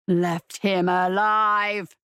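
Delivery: low, slow predator voice; a restrained, drawn-out low roar; predator's roar. A narrator's reading. Predator demon echoes the accusation, low restrained roar. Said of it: "the predator roared".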